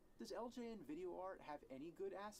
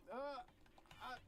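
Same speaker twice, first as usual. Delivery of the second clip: ordinary talk, nothing marked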